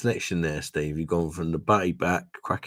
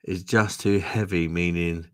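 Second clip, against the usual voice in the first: usual speaking voice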